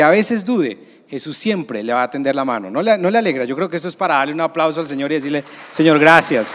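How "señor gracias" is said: a loud, fervent prayer of thanks; loud, full-voiced thanks; loud thanks to the Lord. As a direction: cry it out with gratitude. Congregation invited to thank the Lord.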